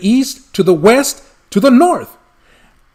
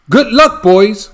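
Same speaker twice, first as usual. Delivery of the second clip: a loud, higher send-off cry; cried out farewell; 5 semitones higher, 5 dB louder than usual. Acted. Lorax crying goodbye to the departing barbaloots, loud and high. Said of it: "he cried as he sent them away".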